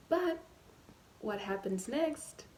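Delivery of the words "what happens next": normal talking voice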